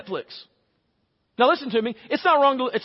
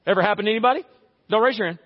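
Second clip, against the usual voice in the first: ordinary delivery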